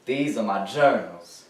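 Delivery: ordinary talk, nothing marked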